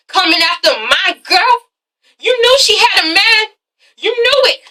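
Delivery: high, indignant mock accusing; acted accusation. German confronting Derek about his girlfriend, acted accusing.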